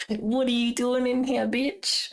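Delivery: high, shrill-edged outraged squeal; indignant squeaky voice. Retold or imitated. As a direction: play the offended program, squeaking. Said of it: "O B S is like".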